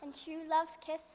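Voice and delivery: gentle bedtime story reading; storytelling. Acted. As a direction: read it like a fairy tale to a child.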